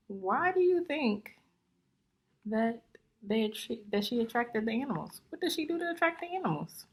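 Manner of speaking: lively, bouncy rhyming storybook reading; story read-aloud. Acted. Teacher reading a rhyming book to young students, lively. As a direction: read it out to the class, full of life.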